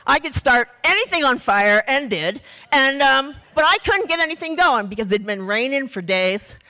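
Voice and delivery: ordinary talk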